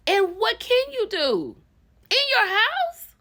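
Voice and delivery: high, loud outraged question; exasperated. Real, not acted. Host outraged for him, voice climbing high. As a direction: rise to an incredulous peak.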